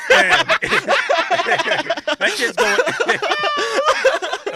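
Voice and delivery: words bursting through loud, high laughter; laughing hard. Real, not acted. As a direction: barely get it out for laughing. Cracking up at robot joke.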